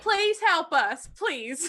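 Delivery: high, slowed plea; begging. Acted. The player begging the ravens for help, high and slow.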